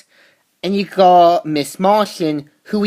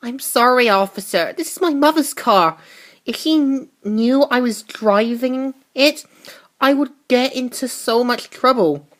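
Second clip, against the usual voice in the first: higher, mock begging to the officer; pleading teen; 6 semitones higher than usual. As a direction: fake innocent girl talking her way out.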